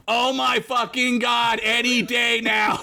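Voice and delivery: high, drawn-out exasperated groan of words; impatient outburst. Acted. Fred on the couch, sick of waiting, exasperated. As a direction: whine it high, fed up with waiting.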